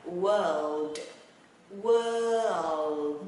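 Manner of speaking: WORLD drawn out slowly through R, L and D; slow, emphatic demo of WORLD; whole word. Shown as a demonstration. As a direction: stretch "world" loudly, landing on a clear final D. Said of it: "Và nguyên một chữ luôn ha".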